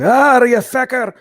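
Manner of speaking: high, loud, drawn-out Scottish outburst; Scottish heckler. Acted. Offended Scottish audience member heckling the hosts, loud Scottish accent. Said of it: "one woke Scottish guy in the audience going".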